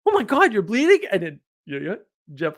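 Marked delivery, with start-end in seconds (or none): 0.1-1.1 sudden high mock panic; mock alarm. Caroline noticing the blood, hammed-up alarm. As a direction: play her sudden switch to alarm. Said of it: "so she switches".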